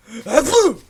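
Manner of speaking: a big explosive sneeze; loud sneeze. Acted. Hidden creature sneezes loudly.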